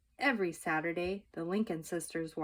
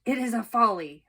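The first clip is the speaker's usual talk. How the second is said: indignant scolding; 4 semitones higher, 8 dB louder than usual. A narrator's reading. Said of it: "She shakes her fist".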